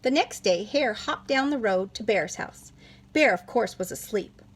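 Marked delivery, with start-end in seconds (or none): none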